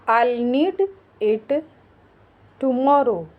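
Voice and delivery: separated, with a long pause before "tomorrow"; word by word. Shown as a demonstration. Teacher modelling English for learners, word by word. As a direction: lay the words out one at a time.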